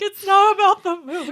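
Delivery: high-pitched weepy objection; mock tearful protest. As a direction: wail it as a pretend crying mom. Mock tearful reply to daughter.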